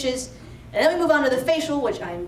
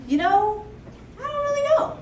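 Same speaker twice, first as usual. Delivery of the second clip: high, wavering voice of the unsure aunt; vague aunt; 12 semitones higher than usual. Retold or imitated. Aunt dodging the boobies question, high and vague. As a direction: voice the aunt as dazed and noncommittal.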